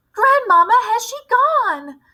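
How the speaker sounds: very high, loud, slow plea; desperate child begging for reassurance; frightened plea. Acted. Frightened boy calling from tree.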